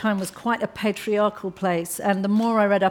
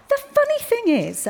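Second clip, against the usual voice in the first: put-on higher, sweetly pointed young woman at the dinner table; a higher, overly polite voice that needles the men; Alice, polite but pointed; 5 dB louder than usual.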